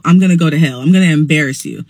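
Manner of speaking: ordinary talk, nothing marked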